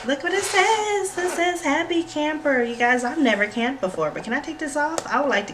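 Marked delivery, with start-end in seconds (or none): 0.1-2.6 thrilled. Real, not acted. Thrilled reading the label.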